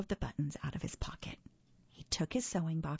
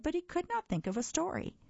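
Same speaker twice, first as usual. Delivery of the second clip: refrain jumps much higher and quicker; rising refrain; 11 semitones higher, faster than usual. A narrator's reading. Toad still stuck on a story, narrator lifts higher. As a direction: lift the refrain up high, quickly.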